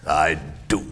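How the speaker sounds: grumpy Scrooge. Acted. Miser scoffs at his nephew's merry Christmas, sour and grumpy. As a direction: grumble it low, with scorn.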